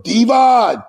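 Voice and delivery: calling out the name loud and high; retold call-out. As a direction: Bubba calling Devon across the ring, shouting. Partner Bubba calling out to him.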